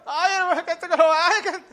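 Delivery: high, hammed-up wailing babble; mock crying. Preacher mimics someone crying at the church, mock wailing. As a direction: blubber in imitation, words dissolving into whimpers. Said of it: "Si yo veo llorando a alguien".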